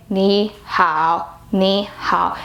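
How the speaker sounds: 你好 as two low, even, separate syllables; robotic double third tone. Shown as a demonstration. Teacher shows the impossible version, robotic low tones. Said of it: "It's like a robot".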